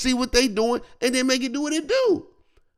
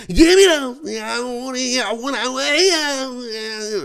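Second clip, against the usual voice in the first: mocking greedy teammate; 3 semitones higher than usual. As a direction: play the ball-hog who grabs everything.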